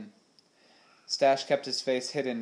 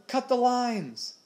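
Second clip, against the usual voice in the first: high, drawn-out urgent yell; long alarmed shout; bellowing; 13 semitones higher, 4 dB louder, slower than usual. A narrator's reading. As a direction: bellow the order, stretching the words. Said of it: "he bellowed".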